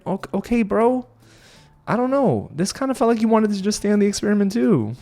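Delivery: doubtful disbelief. Retold or imitated. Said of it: "I'm thinking".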